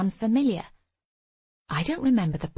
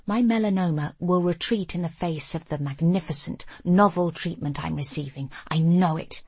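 plain reading voice

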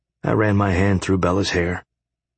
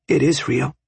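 higher, firm insistence; arguing; 7 semitones higher than usual. A narrator's reading. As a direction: push back firmly, woman's voice. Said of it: "she argued".

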